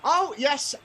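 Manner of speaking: high-pitched pleased aside to customers; lifted market-seller voice; showman stallholder. Acted. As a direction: show off a selling point. Stallholder shows talking duplicate.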